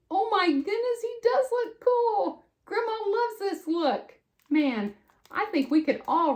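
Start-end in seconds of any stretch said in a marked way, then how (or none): 0.1-4.1 delighted grandma. Real, not acted.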